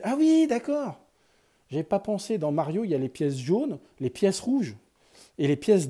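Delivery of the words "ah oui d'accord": much higher, surprised ah-ha; light up as it clicks; sudden realization. Real, not acted. Vlogger realizing the coin colours, surprised high exclamation.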